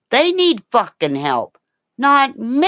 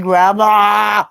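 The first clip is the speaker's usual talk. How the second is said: voicing the dog; 3 semitones higher, 5 dB louder, slower than usual. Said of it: "He's going".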